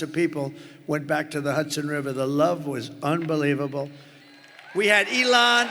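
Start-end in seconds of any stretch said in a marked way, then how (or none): 4.7-5.7 shouted high and loud; excited shout. Real, not acted. Rally speaker hyping the crowd about Elon, shouting. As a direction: sell the name to a roaring crowd.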